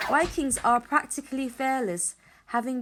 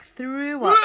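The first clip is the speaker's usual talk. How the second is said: swelling, loud retch on "up"; retching emphasis; 5 semitones higher, 9 dB louder than usual. A narrator's reading. Narrator telling how Warty Hog was sick, retching emphasis. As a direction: heave out the word "up". Acting out the vomiting word.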